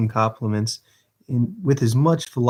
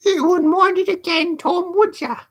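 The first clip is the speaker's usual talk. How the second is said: high Dodger voice. Acted. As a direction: drag it out, high and scornful.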